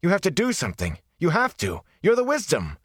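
desperate pleading. Acted. Rand begging the Wisdom to save his father, desperate and high. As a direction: beg her in desperation, voice climbing high.

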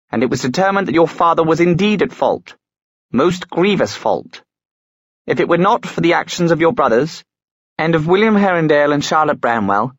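high, stern, relentless; stern inquisitor. A narrator's reading. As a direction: lay out the verdict coldly. Inquisitor lectures Tatiana sternly.

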